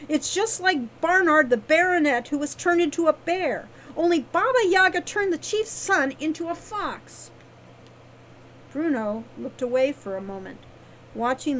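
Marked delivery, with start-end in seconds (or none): 0.1-7.3 high, loud, emphatic explaining; insistent Wren. A narrator's reading. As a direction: argue the case with force, pitched up. Wren arguing her case.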